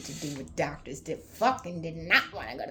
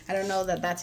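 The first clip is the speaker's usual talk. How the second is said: normal talking voice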